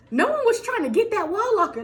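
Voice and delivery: ordinary talk, nothing marked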